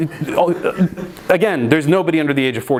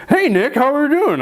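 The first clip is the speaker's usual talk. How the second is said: mock cheerful caller; 11 semitones higher than usual. [name] cheerful high on phone.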